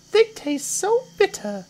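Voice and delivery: very high, loud little croc voice; not-so-big crocodile. Acted. Not-so-big crocodile arguing about children, high complaining voice. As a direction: whine in a tiny creature's pitch.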